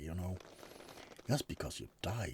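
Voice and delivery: usual speaking voice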